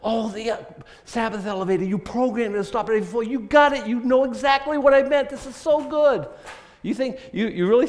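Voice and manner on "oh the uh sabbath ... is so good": higher, louder sarcastic voice of a delighted God; mock pleased God. Preacher mocks legalists by voicing God gushing, high and loud. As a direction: gush with fake delight. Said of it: "God's up there going".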